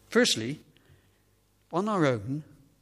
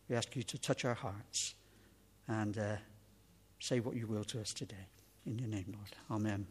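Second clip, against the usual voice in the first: prayer; 4 dB quieter than usual. Real, not acted. Preacher praying before looking into the word, quiet prayer. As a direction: pray quietly, letting the level sink to the Amen.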